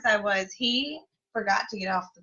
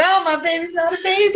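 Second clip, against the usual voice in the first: higher, louder tender gush; mock gushing; 9 semitones higher, 4 dB louder than usual. Mom on son's first bus day, higher gushing coo. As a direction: coo over a grown-up child.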